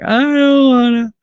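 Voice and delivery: high, drawn-out mock whine; whiny refusal. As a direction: drag it out like a sulky kid. Retold refusal to go out.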